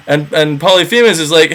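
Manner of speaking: ordinary talk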